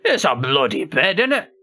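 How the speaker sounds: put-on a playful toff's British accent; mock British accent. Joking the phrase is not British enough, in mock British accent. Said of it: "It's not very British".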